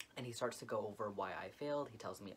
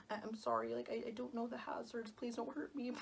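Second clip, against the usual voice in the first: much higher, imitating a frightened whimper; mock terrified plea; 11 semitones higher than usual. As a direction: play yourself begging the examiner not to hurt you. Retold pleading with the angry examiner.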